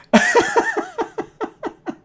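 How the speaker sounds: very high, real laughter; long laugh tailing away; high laughing fit. Real, not acted. Reader cracks up at own jab at the source, laughing.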